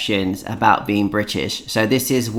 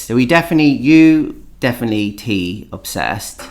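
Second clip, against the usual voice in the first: normal talking voice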